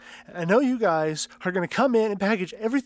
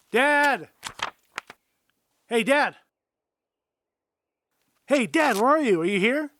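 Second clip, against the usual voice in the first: raised calling voice, searching; 5 semitones higher than usual. Acted. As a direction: shout for his father from room to room. Son searching house for father.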